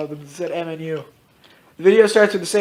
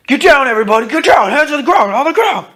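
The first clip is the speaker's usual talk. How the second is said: high, loud barked commands; yelled police orders; 8 semitones higher, 7 dB louder than usual. Acted. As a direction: shout them down to the ground like a raiding cop. Police storming in, yelling orders.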